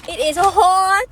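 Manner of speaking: rising whiny complaint about heat; hot complaint. Real, not acted.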